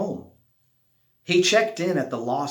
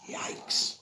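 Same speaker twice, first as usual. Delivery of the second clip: whispered yikes; 9 dB quieter than usual. Acted. Narrator's aside at scary waves.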